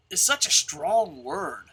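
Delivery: ordinary talk, nothing marked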